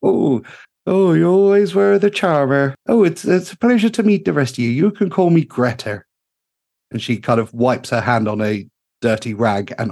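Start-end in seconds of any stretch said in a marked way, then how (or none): 0.0-6.0 bright-eyed gnome engineer calling out in delight; gushing gnome mechanic. Acted. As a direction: sing it out like a cheery little tinkerer. Greta flattered by Mustang.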